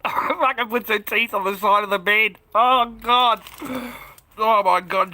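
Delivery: loud, high, laughing through the words; cracking up at his own picture; slow, loud, laughing disbelief; laughing hard. Real, not acted.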